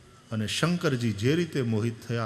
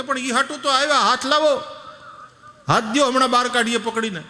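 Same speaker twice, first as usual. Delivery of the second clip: put-on much higher, loud young boys' voice; exasperated youths; 10 semitones higher, 7 dB louder than usual. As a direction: boys pleading impatiently. Boys urge again, exasperated. (ઊંચા અવાજે અધીરા છોકરા)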